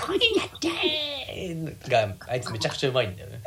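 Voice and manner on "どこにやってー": high, slow, laughing Buu voice; Majin Buu voice. Retold or imitated. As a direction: stretch it out like a childish monster. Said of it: "魔人ブーだよ".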